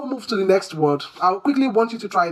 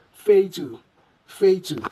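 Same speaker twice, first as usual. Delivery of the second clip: fatal as FAY-tu, first syllable stressed; stress on long A; slower than usual. Shown as a demonstration. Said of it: "Emphasis should be laid on".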